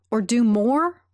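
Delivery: ordinary talk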